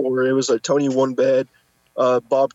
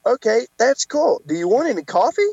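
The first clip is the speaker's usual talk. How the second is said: mock sarcastic cashier; 7 semitones higher than usual. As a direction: imitate a cashier needling a customer. Voices the cashier's sarcastic question.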